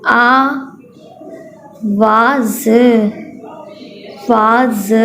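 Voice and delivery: slow syllable-by-syllable sounding; teacher sounding out parts; phonics syllables. Shown as a demonstration. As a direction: break the word into its parts. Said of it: "reading करवा देती हूँ".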